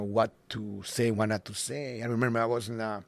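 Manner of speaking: ordinary talk, nothing marked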